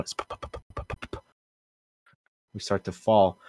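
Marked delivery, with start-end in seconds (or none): 0.1-1.2 soft voiceless "p-p-p" puttering; quiet lip-popped sputter; sputtering engine. Retold or imitated. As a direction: imitate a motor sputtering out. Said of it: "starts to sputter out".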